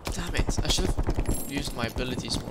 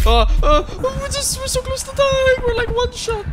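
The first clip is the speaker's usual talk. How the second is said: panicked, high and loud; being chased; 8 dB louder than usual. Real, not acted. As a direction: squeal in panic while fleeing. Being chased, nearly dead.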